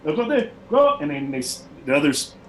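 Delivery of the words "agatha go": high, loud call across the camp. Acted. Barbarian leader calling to his warrior, loud high shout. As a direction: shout the name out high to send her off. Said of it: "he calls off in, like, a fluid language".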